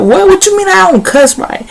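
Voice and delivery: high, incredulous comeback; offended indignation. Retold or imitated. Teen girl snapping back at a friend who mocked her cussing, indignant and high. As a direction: throw it back at her, insulted and disbelieving. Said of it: "I'm mad. I'm in my feelings".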